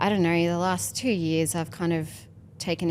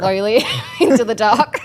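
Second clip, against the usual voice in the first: loud laughing words; 7 semitones higher, 8 dB louder than usual. Real, not acted. Laughing while retelling.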